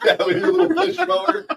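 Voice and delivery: high, loud laugh while talking. Real, not acted. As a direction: laugh through the words. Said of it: "laughing at the joke".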